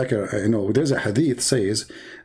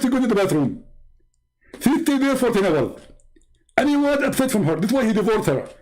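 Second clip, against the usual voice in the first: higher put-on Indian-accented lecturing voice; a smug preacher figure explaining his proof; mock Zakir Naik; 11 semitones higher than usual. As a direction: lecture the caller with mock confidence. Preacher figure tells a made-up story.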